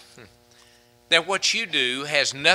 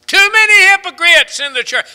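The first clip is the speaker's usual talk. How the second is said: much higher and louder, flung out with scorn; mocking the excuse in a loud cry; mocking shout; 13 dB louder than usual. Shown as a demonstration. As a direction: shout the excuse back to mock it. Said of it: "Have you ever heard that one?".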